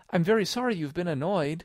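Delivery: put-on high girlish apology; polite, high-pitched sympathy; apologetic Alice. A narrator's reading. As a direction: apologise in a high little-girl voice.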